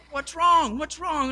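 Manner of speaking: worried, pitched high, what's wrong; alarmed husband. Retold or imitated. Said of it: "And he goes".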